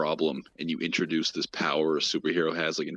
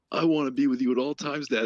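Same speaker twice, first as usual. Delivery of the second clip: put-on high, pleading child voice; imitating the needy kid; 8 semitones higher than usual. Friend retelling the son's plea in a high, pleading voice. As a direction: beg dad like a lonely kid. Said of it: "And the kid's like".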